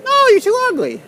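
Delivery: loud high squawk of refusal; Mickey's mock refusal. Mickey refuses the vlogger in a loud high mock voice. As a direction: refuse him in a shrieking voice. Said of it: "Mickey answers".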